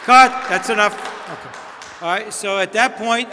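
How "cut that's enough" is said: loud sharp cut-off; halting the applause, loud; calling a stop. Real, not acted. Stopping rehearsal applause.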